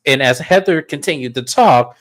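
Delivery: ordinary talk, nothing marked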